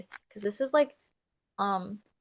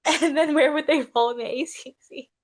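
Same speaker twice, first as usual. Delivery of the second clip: laughing through the words; 6 semitones higher, 7 dB louder than usual. Real, not acted. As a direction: ask it while laughing.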